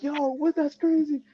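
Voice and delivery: high, loud amazed reaction; hyped fan blown away; a buddy's loud stunned cheer; excited. Retold or imitated. Friend reacts in the crowd.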